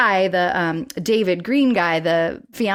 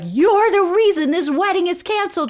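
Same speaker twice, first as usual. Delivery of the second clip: mock-outraged sitcom tirade; Maude accusing; 10 semitones higher than usual. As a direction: hurl the blame, high and theatrical. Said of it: "Maude is like".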